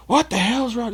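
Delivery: high-pitched mock parent yell; imitating parents screaming at kids. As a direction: parent shrieking at caught kids. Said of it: "Just, just screaming at 'em".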